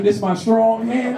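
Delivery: doing the dude from Scary Movie; Scary Movie dude quote. Retold or imitated. Comic quoting the movie dude, slow and high. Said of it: "You know the dude".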